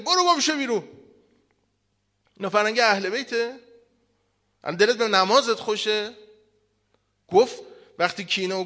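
loud, high fiery preacher's rebuke; preaching at full force. Acted. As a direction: thunder at the congregation like an angry preacher. Fiery sermon rebuking the congregation. (موعظهٔ پرشور و بلند با لحن توبیخ)

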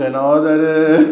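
stretched-out yell, laughing through it; mock shout, laughing. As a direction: call it out slowly while laughing. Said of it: "گفت".

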